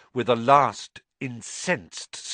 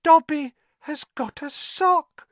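very high, stunned elf voice; can't believe it, squeak it out; elf in disbelief; 4 dB louder than usual. Acted. House elf stunned by the sock: high disbelief. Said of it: "said Dobby in disbelief".

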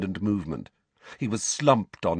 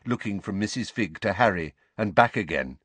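ordinary delivery, nothing marked

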